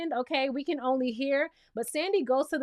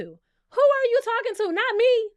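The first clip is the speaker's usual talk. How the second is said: much higher and louder repeat; louder indignant who are you talking to; outraged shout; 10 semitones higher, 5 dB louder than usual. Retold or imitated. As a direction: yell it, fully offended. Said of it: "The way I would have asked Scotty".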